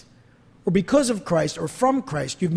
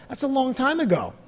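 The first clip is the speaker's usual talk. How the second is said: ordinary delivery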